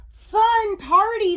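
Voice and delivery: high, loud, drawn-out delight; excited storybook mummy; delighted guest. A narrator's reading. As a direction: sing out thanks as a happy guest. Mummy enjoys the party.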